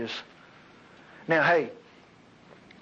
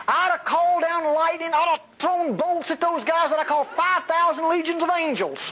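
mock outburst; 9 semitones higher than usual. Preacher imagines himself as God.

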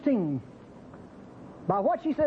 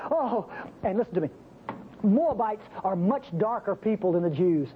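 ordinary delivery

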